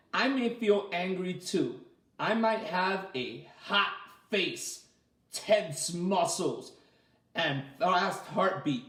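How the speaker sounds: slow, clear storybook reading; gentle read-aloud; reading to children. A narrator's reading. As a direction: read it out slowly for little ones.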